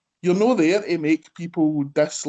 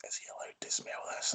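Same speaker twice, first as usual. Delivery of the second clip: hushed, voiceless aside; imitated whisper; 19 dB quieter, faster than usual. Boyfriend quietly asks folk about the non-drinker smelling rum, imitated whisper. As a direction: whisper it furtively, imitating him. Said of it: "he was, like, whispering to folk".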